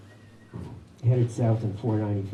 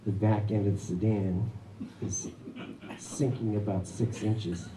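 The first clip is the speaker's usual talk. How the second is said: ordinary talk, nothing marked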